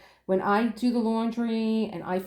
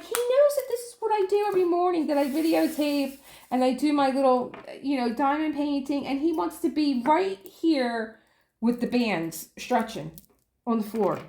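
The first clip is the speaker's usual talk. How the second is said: high, exasperated rant; 7 semitones higher than usual. Real, not acted. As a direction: complain high and fast. Exasperated retelling of husband.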